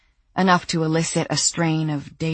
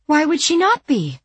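indignant high woman; 12 semitones higher, 5 dB louder than usual. Acted. Sandy's indignant question.

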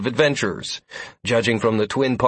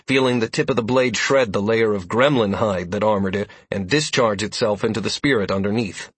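plain reading voice

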